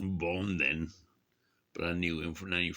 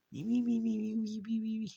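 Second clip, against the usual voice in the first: very high falsetto chatter, fading off; mock-French squeal; 14 semitones higher, faster than usual. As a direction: squeak it out in a rapid cartoon string. Repeats his mock-French squeal.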